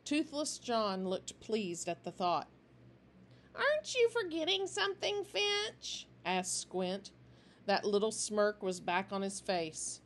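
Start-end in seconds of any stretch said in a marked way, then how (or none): 3.5-6.1 smirking taunt. A narrator's reading. Smirking gang member taunts leader.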